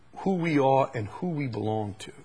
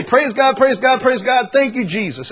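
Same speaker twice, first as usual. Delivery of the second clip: loud, high, fervent praise God; dad shouting praise with fervor; dad's fervent praise; 10 semitones higher, 8 dB louder than usual. Retold or imitated. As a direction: praise God like an eager worshiper. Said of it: "he would say".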